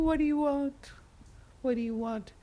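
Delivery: high wind voice, fading on the repeat. Acted. North Wind challenging the boy, calling out. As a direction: call it out high, then ease off.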